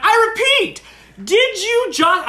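high, loud, slow mock accusation. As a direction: announce it loudly to the whole room. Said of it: "I'm getting a little louder here".

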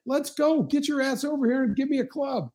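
hammed-up call to come bring a club; mock yelling at caddy. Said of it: "I'm like".

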